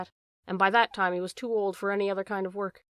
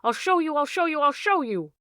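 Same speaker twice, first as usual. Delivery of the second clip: much higher and louder, a wild repeated cry; frantic shouting; 6 semitones higher, 5 dB louder than usual. A narrator's reading. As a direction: yell it while running, half out of control. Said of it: "running down the gallery shouting".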